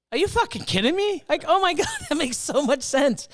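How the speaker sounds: high laughing are-you-kidding-me; incredulous outburst. Retold or imitated. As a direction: blurt it out, floored and laughing. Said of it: "I'm just sat there and I'm like".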